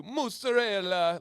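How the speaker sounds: slow, high, hammed-up Italian accent; mock Italian accent. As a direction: overdo it like a cartoon Italian chef. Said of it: "they do this with it too".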